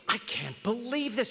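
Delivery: a light stunned aside; mock astonishment. Stunned man in the joke.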